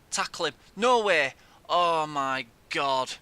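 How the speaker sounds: real loud shocked outburst at the screen; shocked yell. Real, not acted. Gamer yelling at his team as they concede, real loud disbelief.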